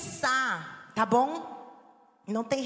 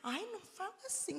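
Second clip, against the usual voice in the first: whiny objector; 7 semitones higher, 10 dB quieter than usual. Pretend. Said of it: "objecting listener voiced".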